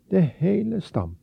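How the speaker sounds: plain reading voice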